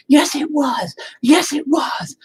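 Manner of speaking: mock wife yelling. Husband mimics his wife yelling back during an argument, in a high mock shout. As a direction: pitch it way up and shout it back like she did.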